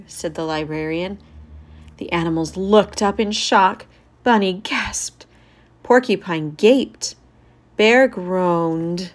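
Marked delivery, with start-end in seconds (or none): none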